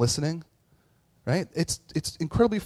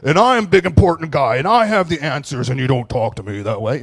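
loud, puffed-up big-shot father; mock domineering parent; 11 dB louder than usual. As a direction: bellow it like an important man who has all the answers. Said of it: "I wanted to".

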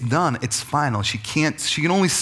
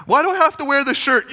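put-on high, protesting kid voice; whining child; 11 semitones higher, 4 dB louder than usual. Child protesting to a parent about the shirt, high whine. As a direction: act out a child's indignant complaint, pitched way up.

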